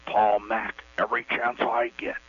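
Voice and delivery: breathy presidential gravity, mock Nixon; Nixon impression. Caller plays Nixon on the call-in show. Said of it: "Okay, here we go".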